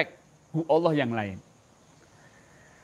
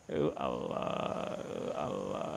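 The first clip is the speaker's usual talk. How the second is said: whispered dhikr; 11 dB quieter, slower than usual. Shown as a demonstration. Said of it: "demo of dhikr breath".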